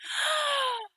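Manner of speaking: sharp high gasp of recognition; excited gasp. Real, not acted.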